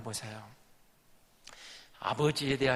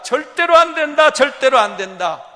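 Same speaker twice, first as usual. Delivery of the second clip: put-on loud, high cry of an old father refusing; father's refusal; 5 semitones higher, 10 dB louder than usual. Father forbidding sons to take Benjamin, loud refusal. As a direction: refuse outright, voice raised high.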